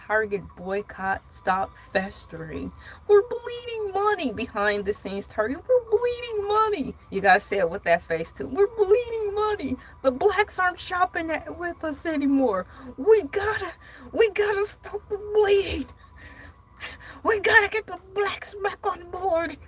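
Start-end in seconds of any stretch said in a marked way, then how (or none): 3.1-6.9 high-pitched mock panic; panicking Target. Host playing Target losing money, high mock wailing. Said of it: "That's Target". 8.5-19.5 high, hammed-up desperate wail; mock-frantic pleading way up high; panicking Target. Target begging for shoppers back, high hammed-up panic. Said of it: "That's Target".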